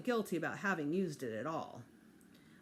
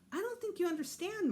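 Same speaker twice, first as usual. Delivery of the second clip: exasperated; 12 semitones higher than usual. Acted. Peter protesting to Mom.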